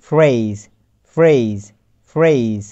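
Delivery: ফ্রেজ with an "ey" glide, falling each time; modelled "ey" vowel. Shown as a demonstration. Teacher drills the same rule, ফ্রেজ with ey vowel and falling tune. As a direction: repeat ফ্রেজ deliberately, spaced out for learners. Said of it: "পূর্বের এ এর উচ্চারণ হয়ে গেছে".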